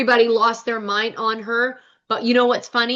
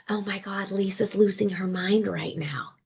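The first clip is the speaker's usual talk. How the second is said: hushed, breathy imitating whisper; imitated whisper; 6 dB quieter than usual. Imitating Heather whispering in the bathroom while Lisa panics, hushed whisper. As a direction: whisper it like you're hiding in a bathroom.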